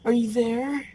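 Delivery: high, anxious calling into the dark; nervous call. Retold or imitated. Homeowner on the stairs calling down at a noise, high and nervous. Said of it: "they start asking the noise questions".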